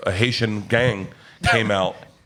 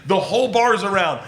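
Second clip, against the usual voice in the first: ordinary delivery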